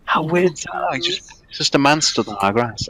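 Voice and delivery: normal talking voice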